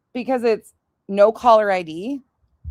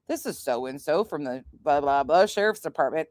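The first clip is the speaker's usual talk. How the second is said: lower, softer official caller voice; mock official caller; 6 semitones lower, 3 dB quieter than usual. Mocking the fake sheriff's caller, low and soft. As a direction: drop into a bored cop-caller tone. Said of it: "mocking the caller's intro".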